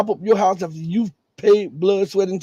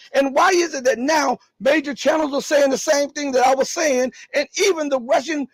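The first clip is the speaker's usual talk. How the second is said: higher and rising to a shout; vindicated excited rant; 7 semitones higher than usual. Real, not acted. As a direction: build up to an indignant peak.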